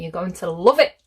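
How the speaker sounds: plain reading voice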